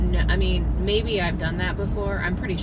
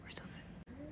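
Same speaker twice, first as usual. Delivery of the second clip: whispering; 8 semitones higher, 21 dB quieter than usual. Real, not acted. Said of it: "but we're still good".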